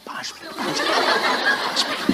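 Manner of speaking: put-on breathy child begging for time; whispered excuse. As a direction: whisper it, slowly, child stalling. (फुसफुसाकर, धीरे-धीरे बच्चे का बहाना)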